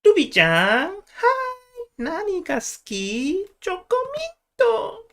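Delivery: very high, drawn-out cutesy Japanese voice; squeaky cute Japanese voice. Acted. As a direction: put on a sugary anime-style squeak. Switches from old-man impression to another.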